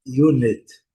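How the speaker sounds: "unit" as yoo-nit; "unit" com iu no início; English unit. Shown as a demonstration. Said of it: "mais fraca". ("unit" dito iunit)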